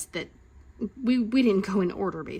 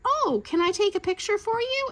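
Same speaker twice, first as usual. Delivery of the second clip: high, sugary customer-service imitation; eager park photographer; 12 semitones higher than usual. Disneyland photographer to guests, eager imitation. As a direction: chirp it like an over-eager employee.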